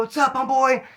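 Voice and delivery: big warm hello before the hug; excited greeting. Retold or imitated. Embracing old friend.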